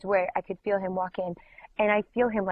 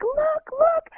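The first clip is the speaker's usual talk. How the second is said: high, loud, eager squeal; acted-out giddy excitement; so excited; 5 dB louder than usual. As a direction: urge them to look, thrilled. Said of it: "just so excited".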